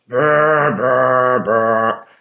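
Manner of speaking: buzzing lips like a horn; trumpet noise. Retold or imitated. Mocking a kid's trumpet practice with buzzing lips. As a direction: blow a mock trumpet blast.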